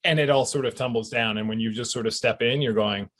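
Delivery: ordinary talk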